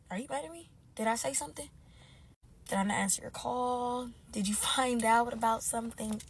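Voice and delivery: very soft, hushed questions; tentative, barely voiced; small worried questioning. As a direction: shrink down almost to a whisper. Acts out anxiously questioning herself.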